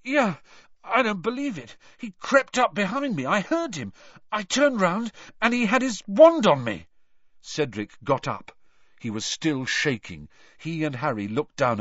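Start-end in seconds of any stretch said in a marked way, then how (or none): 0.0-6.9 high, rattled, breathless telling; shaken. Acted. Cedric telling Harry of the attack, high and shaken. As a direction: spill it out still trembling.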